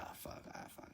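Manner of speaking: breathy whispered swearing under the breath; retold whispered curse. Quentin realizing he lost the bet, whispered curse. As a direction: mutter the curse like you just got caught. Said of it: "And he's like".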